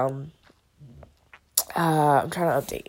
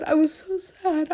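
crying; 11 semitones higher than usual. Real, not acted. Repeated crying line.

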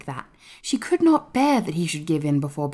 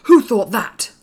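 loud, higher, indignant demand; outraged boy, voice raised; angry; 5 semitones higher, 9 dB louder, slower than usual. A narrator's reading. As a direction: demand it angrily. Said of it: "said Colin angrily".

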